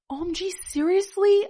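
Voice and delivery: high-pitched mock yell of disbelief; astonished yell. A narrator's reading. Fan whose eyes widened in surprise, mock yell. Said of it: "I yelled".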